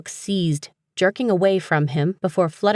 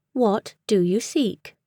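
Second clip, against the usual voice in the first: slow, higher, unchanging British voice; crisp British accent; 4 semitones higher, slower than usual. A narrator's reading. Book repeating its question, crisp British accent. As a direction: ask formally in crisp British English. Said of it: "in a crisp British accent".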